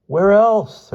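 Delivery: high, loud shaky answer; shaky reply. A narrator's reading. Boots clinging to the branch, a loud high scared reply. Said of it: "a shaky voice replied".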